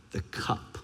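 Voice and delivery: breathy hushed whisper of the word. Acted. Preacher whispers the cup Jesus keeps praying about. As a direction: let it fall to a whisper, heavy with dread. Dramatic repeated word in sermon.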